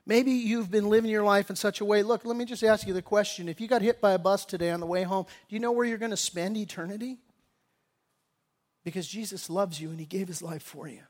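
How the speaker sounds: quiet, earnest invitation to the congregation; soft altar call. Acted. Pastor invites listeners to receive Jesus, soft and earnest.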